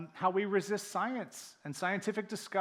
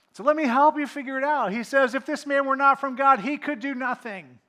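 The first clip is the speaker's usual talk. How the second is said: much higher and louder, driving the point home; fiery preaching; 10 semitones higher, 16 dB louder than usual. Real, not acted. Preacher pressing the healed man's argument, fiery. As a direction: hammer it like a revival climax.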